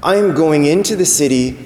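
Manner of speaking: slow, weighty announcement; solemn declaration. Acted. Jesus answers what he is doing entering the city, solemn. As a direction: spell out each phrase slowly and deliberately.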